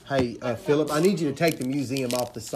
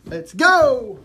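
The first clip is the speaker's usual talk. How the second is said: hype shout; 10 semitones higher, 10 dB louder than usual. Real, not acted. Hype shout during card break.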